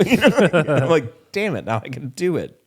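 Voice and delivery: laughing through a mock frustrated line. Laughing retold reaction.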